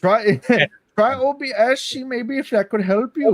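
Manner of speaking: bad German accent, laughing; a tipsy, hammy German accent. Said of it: "I can't do accents all that well".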